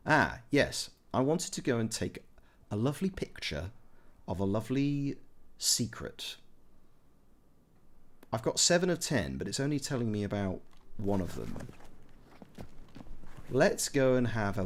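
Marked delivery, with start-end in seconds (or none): none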